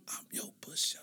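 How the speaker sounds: teasing whisper; conspiratorial mock whisper. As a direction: whisper it like a dealer. Whispered joke offer.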